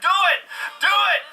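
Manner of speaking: root for the shot like a fan at a game; excited cheering. Real, not acted.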